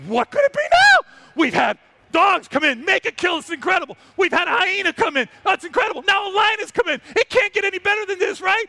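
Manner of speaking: wide-eyed disbelief, shouting with joy; excited. Retold or imitated. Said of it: "And I go".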